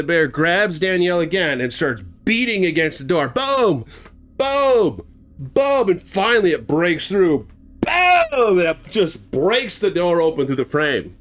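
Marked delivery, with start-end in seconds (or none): 3.3-8.6 imitated slamming booms. Bear beating against door.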